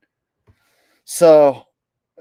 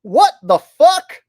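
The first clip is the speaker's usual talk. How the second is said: shocked; 10 semitones higher, 9 dB louder, slower than usual. Real, not acted. Reacting to shocking clip.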